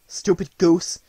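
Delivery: plain reading voice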